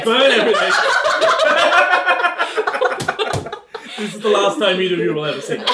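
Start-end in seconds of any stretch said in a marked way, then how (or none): 0.8-3.9 long laughing fit. Real, not acted. Laughing at burn joke.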